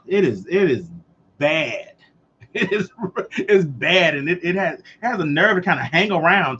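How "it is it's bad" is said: ordinary talk, nothing marked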